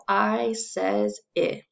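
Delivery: very slow "I says I"; short i sound. Shown as a demonstration. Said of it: "let's say that all together".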